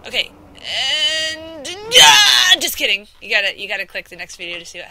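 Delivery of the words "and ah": high, loud faked fall scream; mock scream. Teasing viewers with a fake falling scream. Faking death jump.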